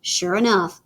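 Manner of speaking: ordinary delivery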